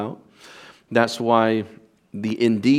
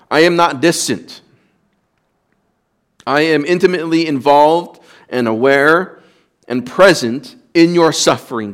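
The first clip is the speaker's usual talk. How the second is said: higher, louder voicing of God's assurance; fervent pulpit declaration; impassioned preaching; 4 semitones higher, 5 dB louder than usual. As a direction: lift it up as God speaking to his people. Preacher voices God's intense assurance.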